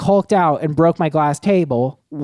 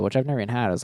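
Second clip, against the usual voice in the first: normal talking voice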